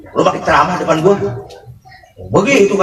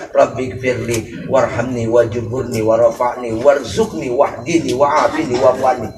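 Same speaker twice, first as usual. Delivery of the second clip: prayer recitation; 4 semitones lower, 3 dB quieter than usual. Shown as a demonstration. Preacher reciting the dua as people pray it, low and soft. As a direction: murmur the dua quietly as in prayer.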